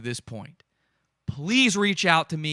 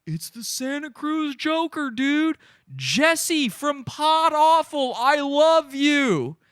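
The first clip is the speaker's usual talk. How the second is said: high, loud, slow fan shout; excited fan shouting; 10 semitones higher, 4 dB louder, slower than usual. Retold or imitated. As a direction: imitate a fan yelling out to him with joy. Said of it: "this amazing man goes".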